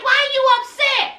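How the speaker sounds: high-pitched imitation of exasperation; exasperated question. Man answering girlfriend's complaint, exasperated question. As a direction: push the question up high, mock impatience. Second, heightened take of his question.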